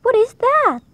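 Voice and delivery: loud, high-pitched startled demand; shrieked question. A narrator's reading. Teacher points at Mac, shrieking in alarm. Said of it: "shrieked teacher".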